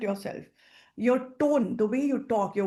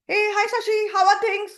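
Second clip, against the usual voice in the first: higher and louder, bursting with energy; energetic greeting; 10 semitones higher, 6 dB louder than usual. Shown as a demonstration. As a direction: greet an old friend with excitement. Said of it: "You see the tone, the energy?".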